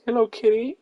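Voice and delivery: cat greeting voice. Real, not acted.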